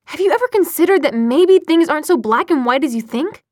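loud, high-pitched challenge; heated argument. A narrator's reading. Jace defending Sebastian to Clary, heated. Jace argues back in quarrel.

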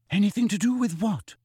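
put-on high, worried question from a nervous girl; lightly voiced anxious Hermione. A narrator's reading. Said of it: "said Hermione anxiously".

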